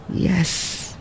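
whispered, crushed yes; murmur. A narrator's reading. Lin Fo admits it to Ada, whispered and crushed. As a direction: barely breathe it out, defeated. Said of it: "murmured Lin Fo".

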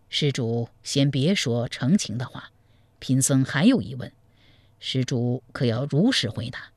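a crafty monk coaxing in a low voice; scheming monk. Acted. Monk advises the groom to blackmail the family, low and sly.

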